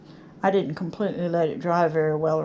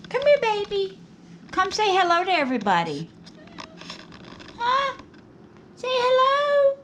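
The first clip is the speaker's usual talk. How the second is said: much higher cooing voice for the cat; 13 semitones higher than usual. Real, not acted. To her cat Sylvester, coaxing high baby talk. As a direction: coax the kitty over sweetly.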